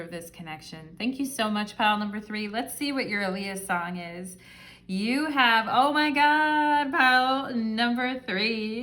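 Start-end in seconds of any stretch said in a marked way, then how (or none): 4.9-8.8 high, loud and drawn-out oh my God; slow, thrilled gasp of surprise; excited surprise. Real, not acted. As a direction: gush with delight at the card. Excited reaction to the song card.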